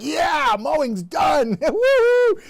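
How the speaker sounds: loud, high victory whoop; triumphant cheer. Acted. Host acting out finishing the lawn, triumphant shout. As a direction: cheer like you just accomplished the world. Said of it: "I'm like".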